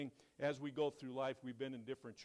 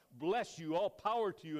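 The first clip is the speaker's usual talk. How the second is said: speaking out loud; 5 semitones higher, 7 dB louder than usual. Retold or imitated. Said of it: "he began to speak out loud".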